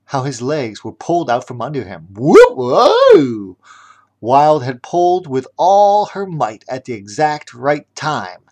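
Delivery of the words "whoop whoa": high loud startled cry; surprised whoa. Acted. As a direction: yelp as legs are pulled. Chef yanked off his feet.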